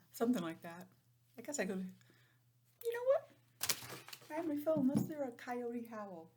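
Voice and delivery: usual speaking voice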